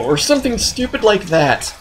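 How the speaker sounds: ordinary delivery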